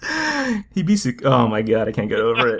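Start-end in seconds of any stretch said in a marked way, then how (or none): 0.0-1.2 slow, high Japanified word, cut short; mock Japanese accent. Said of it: "like, Japanify it".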